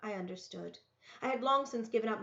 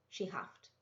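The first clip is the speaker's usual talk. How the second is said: usual speaking voice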